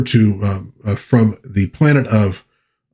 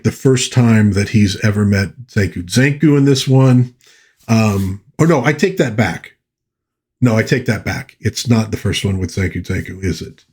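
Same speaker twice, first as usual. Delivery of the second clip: ordinary delivery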